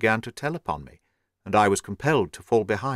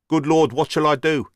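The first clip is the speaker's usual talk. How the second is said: higher, louder anguished cry; despairing cry; 5 semitones higher, 4 dB louder than usual. A narrator's reading. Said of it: "in an ecstasy of despair".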